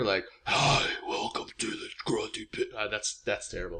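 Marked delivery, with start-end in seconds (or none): 0.5-2.6 Darth Vader voice. Retold or imitated. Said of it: "Darth Vader".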